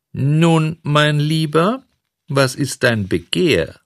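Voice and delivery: high, honeyed politeness; suddenly gracious. A narrator's reading. Flattered director asking the puppet's wish, gracious. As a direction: turn sweetly obliging, drawing the words out. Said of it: "artig und zugänglich".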